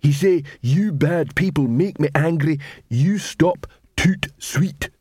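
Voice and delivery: troll voice, slowing on the order; simple-minded troll quoting an order; troll watchman. Acted. Detritus tells Cuddy what Carrot would say, troll voice.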